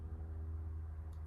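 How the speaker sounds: a sharp, breathy unvoiced gasp of shock. Student hears she was nearly disrespectful, shocked gasp. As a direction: suck in air as if caught out. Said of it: "And I was like".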